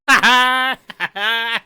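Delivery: high loud mocking cackle; mocking laugh. Stacey laughing at Theo, high loud cackle. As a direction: cackle at him cruelly. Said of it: "she's just like".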